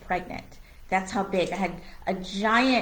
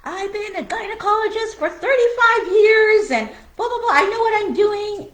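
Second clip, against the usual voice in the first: loud, high mock doctor rant; imitating a pompous shouting gynecologist; doctor raising his voice; 12 semitones higher, 8 dB louder than usual. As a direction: bark it back like the angry doctor. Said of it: "raising his voice and saying".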